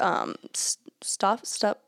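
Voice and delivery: ordinary delivery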